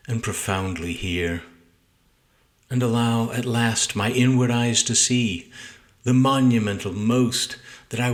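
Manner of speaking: measured, deliberate sonnet recital with pauses between lines; solemn poem read-aloud; poetry reading. Acted. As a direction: recite your poem aloud, weighing each phrase. Reads own sonnet aloud.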